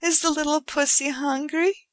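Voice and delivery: high sing-song pet talk; cooing baby talk. A narrator's reading. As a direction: coo at the kitty.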